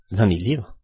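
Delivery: plain reading voice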